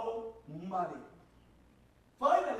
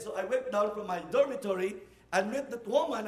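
usual speaking voice

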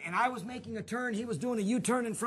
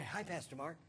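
low, hushed little hello; soft greeting; 7 semitones lower, 13 dB quieter than usual. Retold or imitated. Pastor recalling the kid's greeting that stopped him, softly. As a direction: say it small and quiet.

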